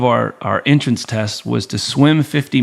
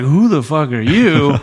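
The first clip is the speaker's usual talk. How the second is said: slow, louder drill-instructor snarl; gruff instructor; 3 semitones higher, 4 dB louder, slower than usual. Retold or imitated. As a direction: bark it at the new guy like a hostile instructor. Voicing hostile instructors at Fort Bragg.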